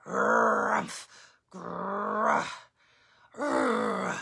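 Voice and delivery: grumbling bear growls. Acted. Old Bear grumbling at the candles, growling. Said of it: "Old Bear muttered and growled".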